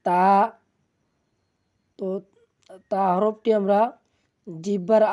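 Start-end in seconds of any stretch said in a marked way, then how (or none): 0.1-0.2 তা with tongue tip on the tooth roots; dental ta. Shown as a demonstration. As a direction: tap the upper tooth roots for তা. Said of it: "দুই দাঁতের গোড়ার সঙ্গে লাগিয়ে". (দাঁতের গোড়ায় জিভ লাগিয়ে তা)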